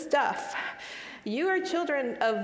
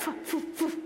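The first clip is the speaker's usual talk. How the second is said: breathy unvoiced poof sound effect; whispered poof; 5 semitones higher, 4 dB quieter than usual. Shame vanishing, whispered poof, poof. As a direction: puff it away like smoke. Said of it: "it all went like".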